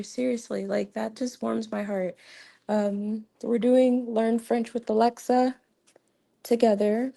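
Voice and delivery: normal talking voice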